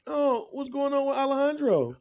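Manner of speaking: high, overdone, concerned voice; mock worried TV hero. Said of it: "He's like".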